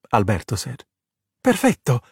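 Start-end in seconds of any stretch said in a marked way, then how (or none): 1.4-2.0 high, bright exclamation; excited Italian cry of approval; exclaiming. A narrator's reading. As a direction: exclaim it with delight. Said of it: "Giulia exclaimed".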